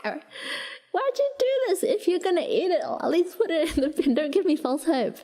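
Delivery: high-pitched, exasperated sibling protest; frustrated complaint. Retold or imitated. Sister scolding brother over the empty melon shell, mock-frustrated. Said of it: "the most frustrating thing".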